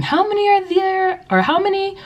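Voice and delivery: high sing-out classroom question; high-pitched kindergarten teacher; teacher asking class. Shown as a demonstration. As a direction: quiz small children in a high voice. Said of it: "just by asking".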